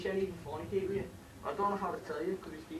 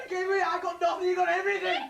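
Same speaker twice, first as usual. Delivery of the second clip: crying shout, much higher and louder; anguished shout; 13 semitones higher, 11 dB louder than usual. Acted. Mickey to his mother on learning the truth, crying and shouting. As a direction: howl it in disbelief and grief.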